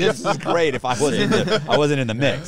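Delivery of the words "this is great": loud, high, delighted; cheering the brawl on from forty feet away; gleeful mock cheer. Gleeful cheer at a prison brawl.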